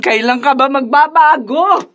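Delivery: slow, crying plea; a worn-out mother breaking into sobs; tearful plea. Acted. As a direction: beg him to change through tears. Weary mother pleads with son.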